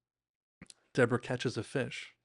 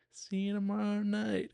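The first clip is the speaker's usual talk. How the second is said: pitched-up munchkin voice, stretched out; imitated D J; 8 semitones higher than usual. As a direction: mimic a little one repeating a goodbye. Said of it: "And D J goes".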